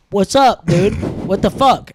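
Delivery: loud, confrontational what's up; retold challenge. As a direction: call him out loudly. Staring down the guard.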